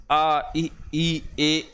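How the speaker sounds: आ, ई, ए sounded out with unrounded lips; vowel demo. Shown as a demonstration. Teacher showing unrounded vowels to the class, careful model sounds. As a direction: say each vowel clearly, lips flat. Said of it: "जैसे".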